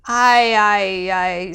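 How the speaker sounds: halting repeated I; nervous stammer. Acted. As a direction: stutter under a stern gaze. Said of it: "stammered Alex".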